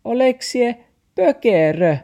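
very high, loud dog voice; barking dog. A narrator's reading. Dog scolds Max after near accident, high barking. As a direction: yap the question like an indignant dog. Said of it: "taksi haukkui".

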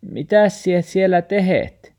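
grandma, high and loud, puzzled; worried question. Acted. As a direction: ask what he's doing in there. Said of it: "murfaari kysyy".

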